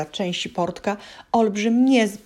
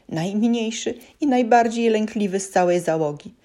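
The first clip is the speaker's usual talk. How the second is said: usual speaking voice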